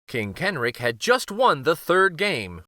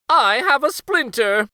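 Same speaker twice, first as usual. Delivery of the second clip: high, loud dismayed cry; upset king; 12 semitones higher, 6 dB louder than usual. Acted. King finding a splinter, high upset cry. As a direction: wail it as the fussy king. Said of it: "tried hard not to cry".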